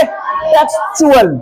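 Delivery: टेक्सचुअल drawn out slowly, with CH before "ual"; demonstrated reading. Shown as a demonstration. Teacher models the word for pupils, slow "teks-chu-al". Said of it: "क्या पढ़ेंगे?".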